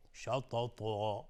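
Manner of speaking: "شططا" slow, heavy t's, long last vowel. Shown as a demonstration. Teacher shows tongue to palate, "شططا" thick and drawn out. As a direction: lift the tongue for a dark shatotoo in "شططا". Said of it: "زبان به طرف سقف دهان میره".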